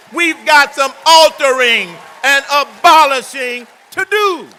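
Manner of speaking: rising to a shout. Acted. Speaker shouting the Declaration's call to abolish to the rally crowd, full shout. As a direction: build from loud oratory to a full, high shout.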